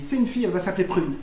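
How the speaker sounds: quick, offhand announcement; breezy old self. Acted. Old [name] telling family of the baby, brisk and offhand. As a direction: rattle off the news without a pause. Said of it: "l'ancien [name] leur aurait dit".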